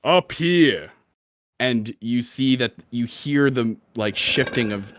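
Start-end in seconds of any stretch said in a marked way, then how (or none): 0.0-0.9 magically amplified voice. Acted. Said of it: "you hear this voice going".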